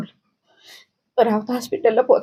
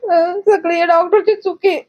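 real tears breaking through, high and slow; sobbing out the accusation, slowed down; crying; 8 semitones higher, slower than usual. Real, not acted. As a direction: voice cracking with grief as she blames the doctor. Grieving mother blames the doctor.

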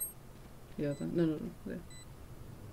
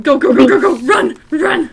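alarm shout; 8 semitones higher, 14 dB louder than usual. Real, not acted. Enemies rushing in, urging teammate.

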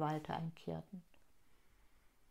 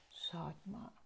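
flüsternd; 2 semitones lower, 12 dB quieter than usual. Acted. Leise auf Doran hinweisen.

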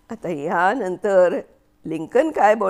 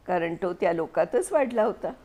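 usual speaking voice

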